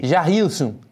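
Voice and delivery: put-on Brazilian pronunciation; Brazilian accent. Presenter playing with Brazilian names, Brazilian accent. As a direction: say the name as a Brazilian would. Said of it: "te sale bien el acento".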